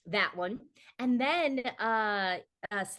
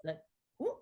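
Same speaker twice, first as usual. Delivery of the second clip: halting mock bewilderment; small, hesitant who-me reaction; baffled imitation. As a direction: act out confused disbelief, in tiny bursts. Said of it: "she looked at me like".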